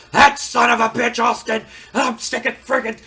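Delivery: a boss yelling that he's sick of the rebel; furious cussing. Retold or imitated. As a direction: blow your top and cuss him out. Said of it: "he's just mad and cussing up a storm".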